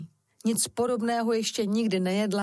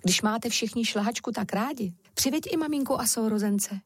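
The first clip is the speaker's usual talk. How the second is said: usual speaking voice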